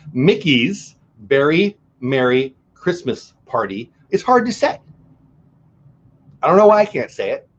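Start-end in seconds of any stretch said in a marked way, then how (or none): 0.1-3.9 Mickey's Very Merry Christmas Party, each word slow and distinct; slow careful retry. Shown as a demonstration. Vlogger retrying the hard name, slow and careful. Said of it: "Let's do this one more time".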